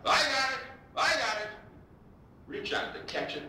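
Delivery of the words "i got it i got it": high, loud outfielder's call; calling off the shortstop, loud and high; shouting. Retold or imitated. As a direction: yell to claim the fly ball. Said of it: "start shouting".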